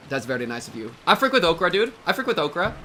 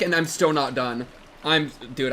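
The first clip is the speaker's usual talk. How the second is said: ordinary talk, nothing marked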